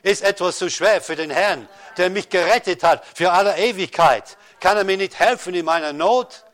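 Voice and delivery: preaching at full force. Acted. Preacher urging the congregation to trust God, loud and rising. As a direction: thunder it out to the congregation, rising and insistent.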